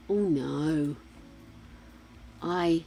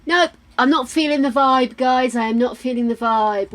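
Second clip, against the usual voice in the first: emphatic nope; 5 semitones higher, 8 dB louder than usual. Real, not acted. Rejecting hairstyle emphatically.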